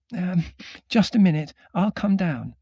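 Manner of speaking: high, loud woman's voice; Margot calling down. A narrator's reading. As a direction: call it out, pitched well up. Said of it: "said Margot".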